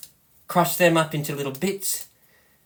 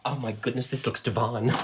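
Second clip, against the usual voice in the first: gasp of delight; 7 semitones lower, 9 dB quieter than usual. Real, not acted. Lifting cover, seeing the dessert.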